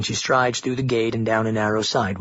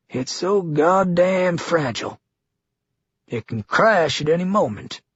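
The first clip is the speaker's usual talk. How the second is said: governor voice; 5 semitones higher than usual. A narrator's reading.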